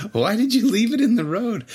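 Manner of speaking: hammed-up disbelief at the friends; exasperated. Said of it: "And I'm like".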